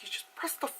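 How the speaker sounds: whispered, exasperated aside; mock-frustrated whispered "fucking". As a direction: hiss it at mom, fed up. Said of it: "I was like".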